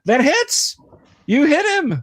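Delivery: high, loud cheer at the hit; excited. Real, not acted. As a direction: shout it out, thrilled.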